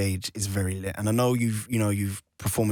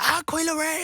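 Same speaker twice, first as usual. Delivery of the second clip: excited fan. Retold or imitated. Imitating fans spotting her.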